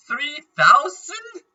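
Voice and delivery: high, slow, astonished rise; surprised. Real, not acted. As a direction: stretch it out in disbelief. Reacting to item price.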